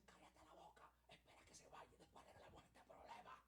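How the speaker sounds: urgent hissed whisper; angry whisper. Retold or imitated. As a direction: whisper it fast so the guest won't hear. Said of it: "Pero así en voz baja".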